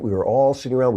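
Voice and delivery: usual speaking voice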